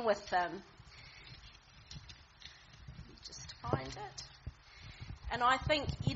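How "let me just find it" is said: whispered aside. Real, not acted. Aside while searching his Bible.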